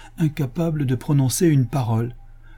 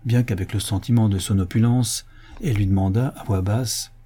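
plain reading voice

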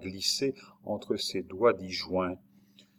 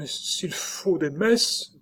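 high, very slow, fearful offer; trembling voice; 9 semitones higher, much slower than usual. A narrator's reading. Frightened nurse offering masses to a ghost, trembling voice. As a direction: draw it out high, trembling with fear. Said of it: "d'une voix qui devenait tremblante".

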